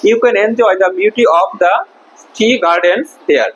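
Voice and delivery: slow, deliberate model sentence; slow reading. Shown as a demonstration. Teacher reading the textbook line slowly to the class. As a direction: spell it out slowly for the pupils. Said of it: "teacher rereads sentence slowly".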